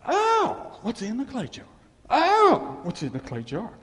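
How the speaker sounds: high gasping oh then wonder; mock tearful realization; sudden realization. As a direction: discover the treasure with awe. Realizing voice, crying out.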